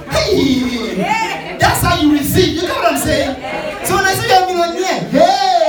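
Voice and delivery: loud joyful preaching shout; excited shout. Real, not acted.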